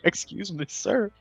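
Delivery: high, soft mock-offended voice; mock-polite objector. Player joking as an affronted creature, high and soft mock politeness. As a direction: pipe up softly in pretend protest. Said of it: "Just like".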